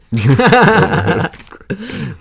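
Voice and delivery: big burst of laughter; loud laugh. Real, not acted. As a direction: crack up hard. Big laugh after punchline.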